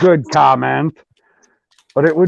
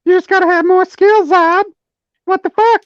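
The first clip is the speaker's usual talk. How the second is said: very high voice. Acted. Continues squeaky mockery.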